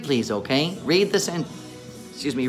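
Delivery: ordinary delivery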